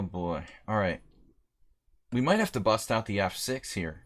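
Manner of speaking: ordinary delivery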